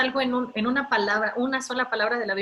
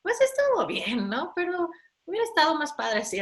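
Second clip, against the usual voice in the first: put-on higher, offhand teenager's voice, lightly done; higher, breezy teen quip, sketched rather than fully acted; casual teen reply; 7 semitones higher than usual. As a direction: shrug it off like a laid-back teen. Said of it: "y ellos me dicen".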